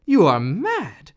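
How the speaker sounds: loud, slow shocked rebuke; stern rebuke. A narrator's reading. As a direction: slam down the judgment. Apothecary rebukes Big Klaus.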